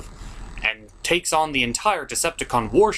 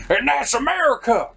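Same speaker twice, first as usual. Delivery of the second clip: patriotic shout; 11 semitones higher than usual. Acted. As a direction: belt it like a cheesy action-movie patriot. Patriotic Team America style outburst.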